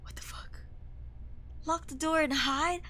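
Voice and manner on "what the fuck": hushed frightened whisper; scared whisper. Real, not acted. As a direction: breathe it under your breath. Whispered in fear.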